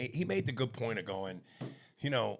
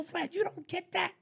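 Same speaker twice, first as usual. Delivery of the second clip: mock disbelief. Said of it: "And I go".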